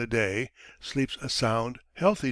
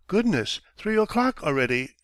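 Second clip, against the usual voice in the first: moaning; 6 semitones higher than usual. A narrator's reading. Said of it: "he moans".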